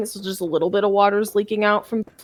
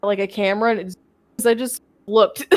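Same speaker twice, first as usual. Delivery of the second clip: plain reading voice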